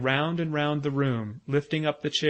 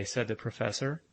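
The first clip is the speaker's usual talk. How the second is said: ordinary delivery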